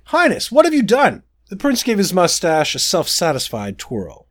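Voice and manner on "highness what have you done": high, panicked question; 驚きの声; alarmed. Acted. Horrified at the spell.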